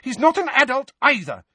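very high, loud comeback; agitated motherly retort; heated retort. A narrator's reading. Mother snaps back, flushed.

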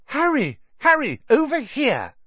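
high, excited call across a street. A narrator's reading. Hermione to Harry from the steps, high calling out. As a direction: call a friend over, pitched right up.

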